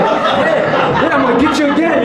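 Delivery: high, quick acted threat; mock fired-up taunt. Acted taunt during fight.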